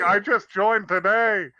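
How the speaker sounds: put-on eager young soldier. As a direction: chirp it like a doomed rookie. Voicing doomed soldier.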